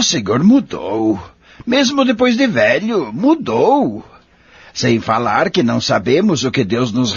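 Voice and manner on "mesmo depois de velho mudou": emphatic delight. Acted. Friend insisting the old man changed.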